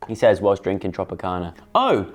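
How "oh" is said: acted disappointed objection.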